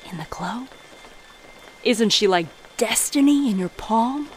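normal talking voice

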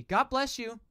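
ordinary delivery

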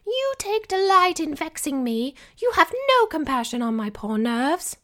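high, loud, shrill whining grievance; shrill mother. Acted. Wife complains to husband about her nerves, shrill and loud. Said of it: "her voice shriller by the second".

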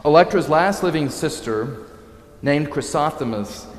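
ordinary delivery

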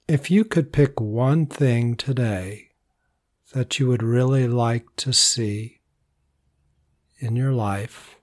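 calm, unhurried voice with long pauses; soothing meditation lead, phrases trailing softly; guided meditation. Real, not acted. Leads a body relaxation meditation.

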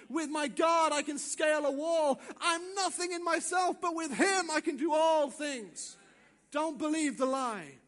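loud, high triumphant declaration; full-force preaching. Real, not acted. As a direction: build a rousing shouted proclamation of faith.

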